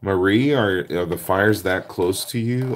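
loud, high concern for a viewer; alarmed. Real, not acted. Crafter reading about wildfires near a viewer, alarmed. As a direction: call out worried about the fires.

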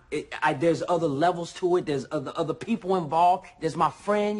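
heated and insistent; agitated protest. Acted. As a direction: plead your case loudly, getting worked up. Defending himself in a quarrel.

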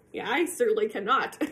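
ordinary delivery

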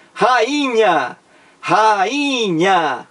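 "Rainha" drawn out as Ra-iii-nha; stressed syllable stretched. Shown as a demonstration. Teacher showing students the strong syllable, "Rainha" slowly stretched on "i". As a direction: stretch "Rainha", leaning on the long "i". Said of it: "A sílaba mais forte".

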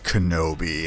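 low, soft menacing Vader voice; Darth Vader. Retold or imitated. Vader greeting his old master, low and quiet menace. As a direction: drop low and quiet, like a looming villain. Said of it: "and Vader's like".

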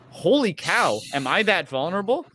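usual speaking voice

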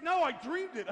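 loud, high, indignant retort; mad wife. Retold or imitated. Wife explains to husband it was a dream, mad. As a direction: imitate an exasperated wife snapping back. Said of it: "she wakes up mad".

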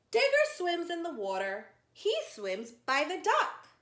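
lively storybook read-aloud for little ones; reading to children. Acted. Teacher reading a zoo story to children, lively storytime voice.

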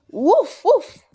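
loud high yappy puppy woof; puppy barks. Acted. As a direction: bark like an eager little puppy. Voicing the puppy Biscuit.

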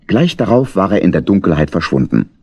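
ordinary delivery, nothing marked

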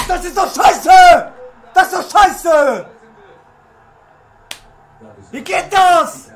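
loud, drawn-out cries of frustration; slow, exasperated shouting; outraged shout. Real, not acted. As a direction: yell in angry disbelief at the game. Losing player protests after goal.

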